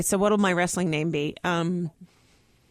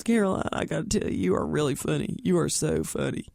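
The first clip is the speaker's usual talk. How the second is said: lightly imitated low presidential voice; low, sincere-sounding praise in a president impression; Bill Clinton; 4 semitones lower than usual. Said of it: "President Clinton came over to me and goes".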